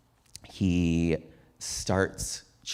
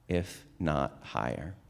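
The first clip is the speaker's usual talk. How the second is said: softer and slow, a quiet aside; quietly; 4 semitones lower, 6 dB quieter, slower than usual. A narrator's reading. The litvak quietly adding to the disciple's tale, softly and slowly. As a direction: say it low and unhurried, a quiet certainty. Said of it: "He only adds quietly".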